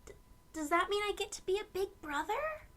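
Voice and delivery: high, fast, eager question; excited child. Acted. Aiden asking his parents about being a big brother, high and excited. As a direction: bubble over with hope. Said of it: "Mom announced".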